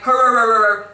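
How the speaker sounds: loud comic hurr grunts; mock guard grunting. The guard answering Gomi, loud mocking grunts. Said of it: "the guard's voice was like".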